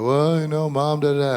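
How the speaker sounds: put-on whiny crying kid. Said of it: "she's like".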